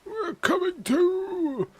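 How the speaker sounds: high, slow mock sob; mock crying. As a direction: whimper it slowly, very high. Streamer parrots game line, crying.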